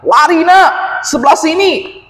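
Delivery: high, loud, urgent shout to run; father yelling at his son to flee the avalanche. Acted. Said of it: "Ayah bilang". (teriakan ayah yang mendesak, keras dan tinggi)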